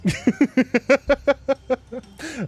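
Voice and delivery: long high-pitched laugh; high giggle. Real, not acted. Vlogger cracking up at her dog command, high giggle. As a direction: giggle at your own joke.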